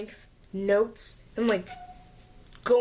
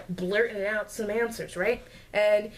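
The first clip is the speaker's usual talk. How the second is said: ordinary talk, nothing marked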